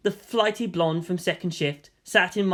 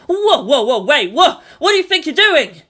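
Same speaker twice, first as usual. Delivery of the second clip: high, loud panicked yelps with a laugh; flustered shriek of alarm; a boy caught off guard, yelping in panic; startled yelp; 9 semitones higher, 10 dB louder than usual. Acted. Shinji startled by sudden advance.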